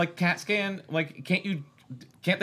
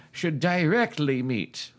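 "directly" weighted and spelt out; stress on DIRECTLY; slower than usual. A narrator's reading. Narrator reading the book's marked word, stress on "directly". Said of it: "Emphasis on directly".

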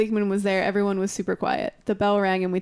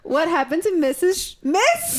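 shocked high question; 7 semitones higher than usual. Pretend.

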